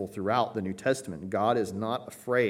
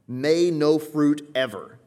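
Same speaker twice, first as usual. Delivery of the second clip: slow and loud, each word weighted; a solemn decree, slowed right down; emphatic; 5 semitones higher, 7 dB louder, slower than usual. Shown as a demonstration. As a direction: press down hard on "May no fruit ever". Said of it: "This is the emphatic no".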